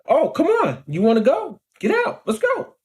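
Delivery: high-pitched goading, retold; acted fighting challenge. Challenging the driver as he gets out, acted high-pitched goading. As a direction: replay squaring up for a fight.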